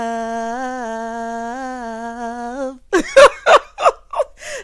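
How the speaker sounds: big real laugh, breaking up; a long, loud, helpless laugh. Real, not acted. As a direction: burst out laughing at the joke. Laughing at her own line.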